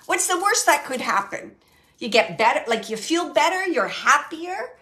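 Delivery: mock-obvious, pushing each phrase; playful sarcasm. Medium repeats the spirit's dare to take a chance, playful sarcasm. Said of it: "It's like she's being sarcastic".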